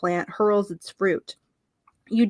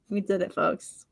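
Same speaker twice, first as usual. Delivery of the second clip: ordinary talk, nothing marked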